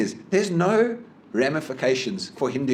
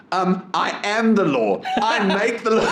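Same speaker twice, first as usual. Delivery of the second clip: high, slow, self-important mimicry; pompous caller impersonation; 8 semitones higher, slower than usual. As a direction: declare it grandly as the mocked caller. Imitating caller's pompous claim.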